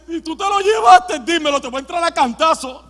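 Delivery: high, loud, hammed-up confrontation; mock threat. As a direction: play a distraught woman threatening a stranger. María amenaza al hortelano, exagerado. (amenaza exagerada, aguda y a gritos)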